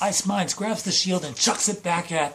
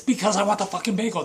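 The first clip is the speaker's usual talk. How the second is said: higher, quick mock-angry comeback; heated retort; 6 semitones higher, 5 dB louder, much faster than usual. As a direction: fire back in an acted tantrum. Acted argument over a bagel.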